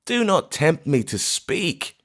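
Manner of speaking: put-on high, slow, warning old man; bitter scorn. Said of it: "His accent of bitter scorn".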